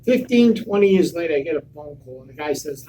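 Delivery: normal talking voice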